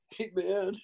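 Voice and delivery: high-pitched imitation of an awed buddy; Bill Paxton. Actor imitates old friend leaning back on the couch, high-voiced impression. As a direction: mimic a friend's hushed-up disbelief, pitched high. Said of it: "the only way Bill can do it".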